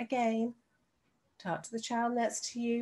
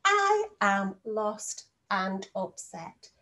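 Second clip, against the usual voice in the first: whole sentence slow and even; slow read-back; much slower than usual. Shown as a demonstration. Teacher reading the finished speech bubble to the class. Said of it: "full stop in a speech bubble".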